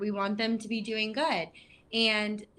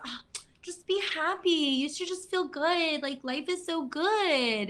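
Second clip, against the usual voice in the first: mock cheerful advice; 8 semitones higher than usual. Speaker mocking people who tell the depressed to be happy, sing-song. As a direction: parody a relentlessly upbeat friend.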